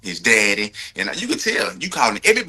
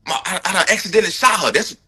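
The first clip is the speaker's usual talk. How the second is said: imitating a panicked husband; 7 semitones higher than usual. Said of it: "what a real husband would've did".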